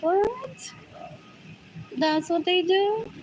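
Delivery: high incredulous what, slowed; imitating shocked disbelief; surprised. Siblings quoted in mock disbelief.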